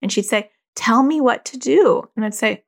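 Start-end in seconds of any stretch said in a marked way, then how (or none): 0.7-2.1 high, loud, drawn-out imitated plea; demanding daughter. Daughter pressing her hesitant mom for advice, imitated demand. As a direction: act a teen insisting on an answer.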